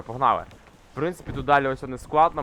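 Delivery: ordinary talk, nothing marked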